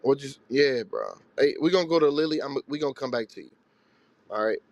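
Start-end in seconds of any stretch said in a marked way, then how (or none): none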